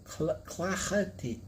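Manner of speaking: Clahety said as "KLAH-heh-tee", slow; copying the site's pronunciation. Shown as a demonstration. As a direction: mimic the audio's Clahety carefully. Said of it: "then this one says clahety".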